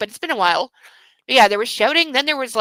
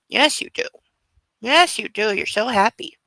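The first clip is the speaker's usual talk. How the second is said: ordinary delivery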